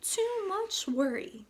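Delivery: high, slow granny tutting; grandmother's voice. A narrator's reading. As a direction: shake your head like a granny. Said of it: "said her grandmother".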